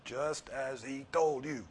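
"told" drawn out and falling, slow; stress on told. Shown as a demonstration. Preacher showing how the angel's words ring, slow stress on "told". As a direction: lean hard on "told" and let it fall. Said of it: "This is just how it hits me".

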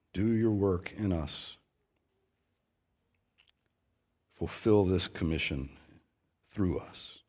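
soft, low reverent prayer; closing prayer. Acted. Pastor closing the sermon in prayer, soft reverent prayer. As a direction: pray quietly and humbly over the people.

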